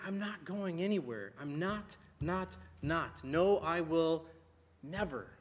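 deliberate, separated beats on "not, not, not"; emphatic. Retold or imitated. Preacher voicing God's promise to stay, stressing each "not" and "never". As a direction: pause before a final, set-apart "never". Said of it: "there's this emphasis".

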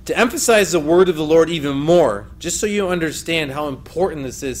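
normal talking voice

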